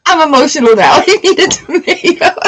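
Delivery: giggling as she repeats her catchphrase; laughing. Real, not acted. Host laughing at her own tomato line with viewers, laughing.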